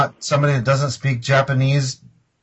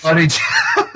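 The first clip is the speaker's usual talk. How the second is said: laughing outburst; slower than usual. Real, not acted. Caller breaks into real laughter recalling the barmaid's line. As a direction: crack up as you try to say it. Cracking up retelling the call.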